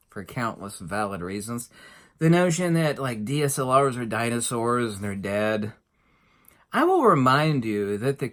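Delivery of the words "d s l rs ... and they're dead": ordinary delivery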